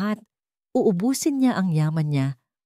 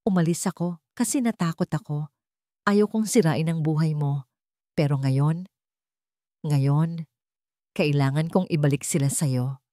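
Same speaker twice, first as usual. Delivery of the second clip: plain reading voice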